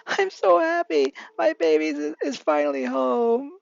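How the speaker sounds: high, drawn-out happy wail; tearful joy. A narrator's reading. Mother overjoyed her baby is home, mock tearful wail. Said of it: "Inko then bursts into tears".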